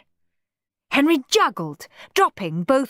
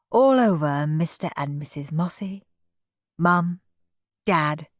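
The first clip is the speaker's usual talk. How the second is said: ordinary delivery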